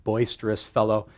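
ordinary talk, nothing marked

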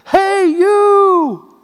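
high, loud call from a window; yelling down. Retold or imitated. A man yells from a window to Steve on the street. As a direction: shout it down from the sixth floor. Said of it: "yelled down".

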